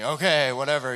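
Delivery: begrudging. Retold or imitated. To God on his bed, a grudging okay. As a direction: shrug it off like you're giving in. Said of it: "Kind of begrudgingly".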